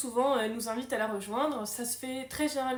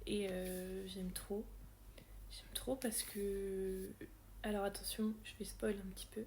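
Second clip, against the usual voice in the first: unvoiced secret whisper, softer; 4 semitones lower, 10 dB quieter than usual. Real, not acted. Booktuber whispering a spoiler warning to viewers, hushed.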